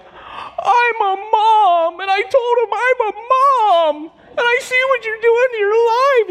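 very high, drawn-out mock wail; hammed-up tearful squeal of a mother; imitated weeping mom. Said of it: "And she's crying".

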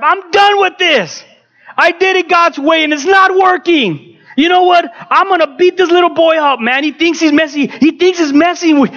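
high-pitched, reenacted furious outburst; upset rant. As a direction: rant like a father at his limit. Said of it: "I finally got upset".

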